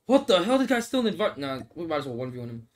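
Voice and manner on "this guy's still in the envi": ordinary delivery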